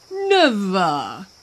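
put-on hippo voice, drawn-out word; hippo's vow. A narrator's reading. Hippo reassures the fish.

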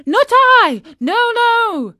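very high, loud wailing denial; acted tearful shriek of denial; sobbing bitterly. A narrator's reading. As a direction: wail in protest like a frantic puppet. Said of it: "sobbing bitterly".

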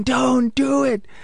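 high, slow shouted warning at the screen; mock movie-house shout. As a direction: yell at the character on screen. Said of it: "you just hear people in the movie house shouting".

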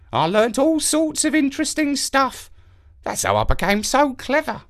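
high odd wise crow voice; proud ancient crow; raspy cawing crow. Acted. Crow explains.

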